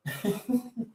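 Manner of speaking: a soft breathy laugh; quiet amused laugh. Real, not acted. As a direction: chuckle under the breath. Laughing at own story.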